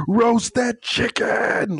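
slow, hammed-up hype cry; excited fan yell. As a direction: belt it like a random game-day outburst. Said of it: "I'll say things like".

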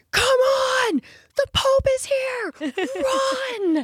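excited yell. Retold or imitated. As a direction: yell at her to run to the Pope. Husband rushing her to the Pope.

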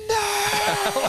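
very high, drawn-out anguished scream; demonic scream. Retold or imitated. Runner learning the tailwind is really a headwind, demonic scream. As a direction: let out a long demonic howl of despair. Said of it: "one of the demonic screams".

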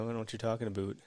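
quick, lightly hammed-up Wisconsin accent; put-on Wisconsin accent. Host joking about his own local accent, mock Wisconsin accent. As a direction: toss off a mock Wisconsin "aboot". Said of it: "stuck up here with this Wisconsin accent".

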